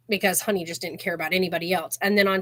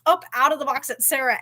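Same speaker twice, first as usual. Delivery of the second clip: ordinary talk, nothing marked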